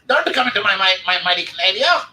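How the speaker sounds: high, loud cartoon Canadian accent; South Park Canadian voice. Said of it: "they give them all that voice when they talk like this".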